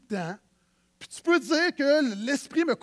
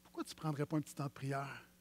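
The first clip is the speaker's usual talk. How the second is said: much softer and lower, like a gentle inner voice; small inner voice; 8 semitones lower, 12 dB quieter than usual. Pretend. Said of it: "la petite voix à l'intérieur qui dit". (petite voix intérieure, beaucoup plus douce et plus grave)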